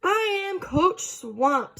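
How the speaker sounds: slow, high, deliberate stern coach; a hint of a stern coach. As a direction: announce yourself slowly like a tough coach. Stern coach introduces herself.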